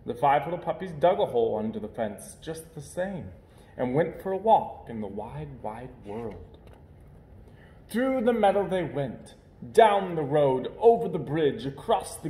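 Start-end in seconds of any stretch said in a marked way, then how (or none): none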